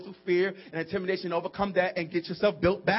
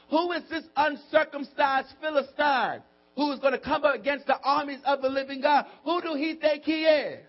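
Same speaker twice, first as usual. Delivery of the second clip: high, ringing sermon delivery; full-force preaching; 6 semitones higher, 3 dB louder than usual. Acted. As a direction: preach it as David's bold defiance. Preaches David's defiance at full force.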